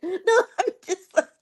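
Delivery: high, squeaky-with-laughter protest; laughing. Real, not acted. Singer recovering from her joke, laughing. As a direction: try to talk through your own laughter.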